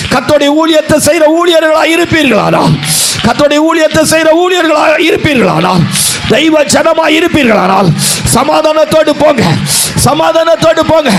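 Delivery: loud, high, rousing sermon climax; fiery pulpit shout; full-force preaching. Acted.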